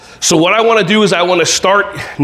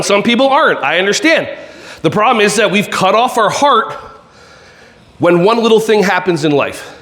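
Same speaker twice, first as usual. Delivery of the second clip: ordinary talk, nothing marked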